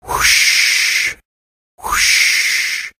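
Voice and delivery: breathy "whoosh" like surf; drawn-out whooshing wave noise; crashing waves. Acted. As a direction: imitate waves rolling in, unvoiced hush. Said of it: "sound of crashing waves".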